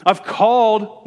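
calling out. Acted. Voicing woman wisdom's call.

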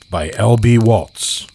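usual speaking voice

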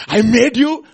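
high, loud and emphatic cry; loud proclamation. Acted. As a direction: proclaim it as the maker. Boy speaks to his boat.

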